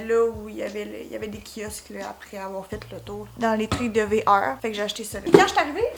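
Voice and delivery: ordinary talk, nothing marked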